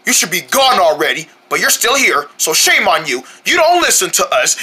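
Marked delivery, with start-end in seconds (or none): none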